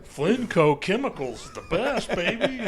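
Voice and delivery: half-laughing show-off; laughing mock boast. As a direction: boast like a cocky CEO through laughter. Mock CEO bragging, laughing.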